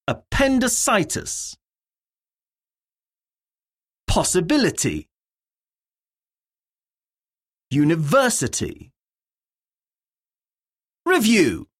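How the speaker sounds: each word said alone, stressed syllable clearly hit. Shown as a demonstration. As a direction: model the stress for the class to repeat. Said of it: "Words have stress on different syllables".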